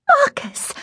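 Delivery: put-on very high, delighted child's call; gleeful little-girl squeal of a name; happy call. A narrator's reading. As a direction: call out happily to a friend. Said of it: "Honoria called out happily".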